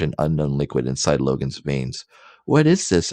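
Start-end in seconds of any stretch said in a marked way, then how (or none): 2.4-3.1 high voiced Logan. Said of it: "asked Logan".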